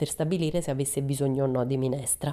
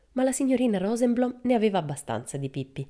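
plain reading voice

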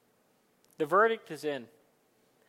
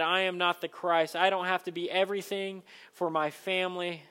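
fervent, louder prayer voice; 2 semitones higher, 5 dB louder than usual. Acted. Pastor praying over the communion meal, fervent. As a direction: lift the prayer up with force.

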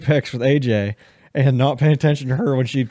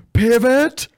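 high, loud repeated shout of Pivot; pivot yell; 4 dB louder, much slower than usual. Retold or imitated. Retelling carrying a couch up stairs, yelling pivot. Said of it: "Please tell me you yelled, "Pivot".

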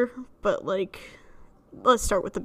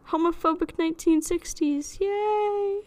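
sarcastic cheer; 7 semitones higher, slower than usual. Acted.

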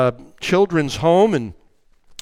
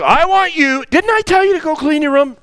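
louder, quicker nagging; irritated repeat; 8 semitones higher, 4 dB louder than usual. Retold or imitated. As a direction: let impatience creep in. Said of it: "Number two".

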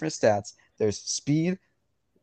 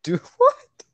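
squeaky high, loud "Do what?"; a high, loud yelp of disbelief; shocked; 12 semitones higher, 10 dB louder, much slower than usual. Real, not acted. Shocked brand is real.

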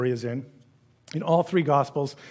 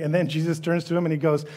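ordinary delivery

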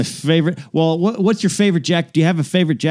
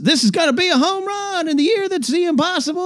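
much higher, soaring play-by-play; Vin Scully call. Retold or imitated. Recreating Vin Scully's famous call, soaring high. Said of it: "I love Vin Scully's".

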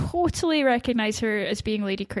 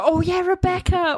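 dramatic name call; 8 semitones higher than usual. Pretend.

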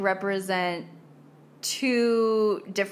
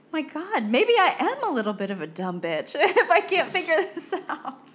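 higher, breaking into laughter; half-joking self-mockery; 6 semitones higher than usual. Retelling a joke about failing at French, half-joking and laughing. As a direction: laugh through a self-deprecating admission. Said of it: "half joking with someone".

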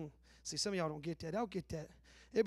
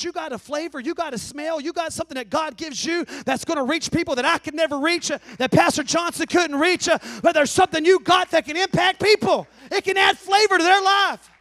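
building sermon; 5 semitones higher, 12 dB louder than usual. Acted. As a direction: climb louder to a preaching peak. Preaching climax.

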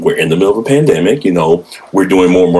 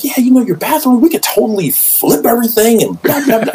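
high-pitched imitation of a keen salesman; mock the contractor's big pitch in a much higher voice; eager contractor patter. Contractor selling a bathroom overhaul, imitated eager patter.

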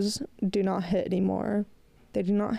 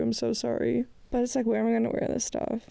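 ordinary delivery

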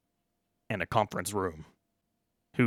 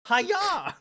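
sharp martial-arts shout, much higher; Jackie Chan kiai. Acted. Guard introducing himself as Jackie Chan, high kung fu yell. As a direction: yelp it like a kung fu cry. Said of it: "My name's Jackie Chan".

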